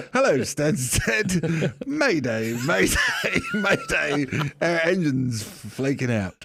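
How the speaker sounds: a pilot faking an emergency to the tower, hammed-up and laughing; mock mayday call. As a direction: fake a panicked radio mayday while laughing.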